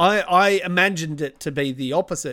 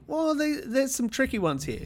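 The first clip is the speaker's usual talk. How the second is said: soft, higher-pitched aside to oneself; hushed, high quoted musing; quoted private thought; 7 semitones higher, 7 dB quieter than usual. Retold or imitated. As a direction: voice a wary thought, lightly and higher. Voicing own thought on the quiz.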